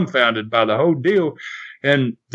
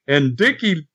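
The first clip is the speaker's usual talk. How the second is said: ordinary talk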